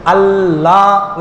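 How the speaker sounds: আল্লাহ with the double L held on the palate, low and drawn out; held L sound. Shown as a demonstration. Preacher shows where the tongue touches, held L in আল্লাহ. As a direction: rest the tongue on the L of আল্লাহ, slowly. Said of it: "মাটির সাথে জিহ্বাটা একটু লাগে".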